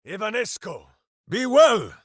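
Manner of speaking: loud, high spell call; spell shout. Acted. Ranger casting a heal on a friend, loud call. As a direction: bellow the blessing out.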